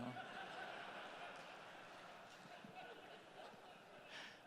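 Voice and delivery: long breathy laugh at his own joke; amused audience-sharing laugh. Real, not acted. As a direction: let a soft airy laugh roll out.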